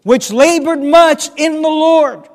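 "labored much" high, loud and drawn out; emphasis on "labored much". Shown as a demonstration. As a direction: stretch "much" and proclaim it loudly. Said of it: "Notice the emphasis".

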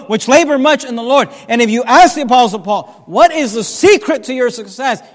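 loud, building pulpit oratory; forceful preaching. Acted. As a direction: pile up the phrases with rising force.

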